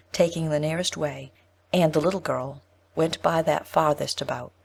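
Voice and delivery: plain reading voice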